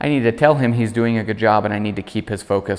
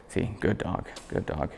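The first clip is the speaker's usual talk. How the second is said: soft praise; 3 semitones lower, 12 dB quieter than usual. Real, not acted. Soft praise to dog nearby.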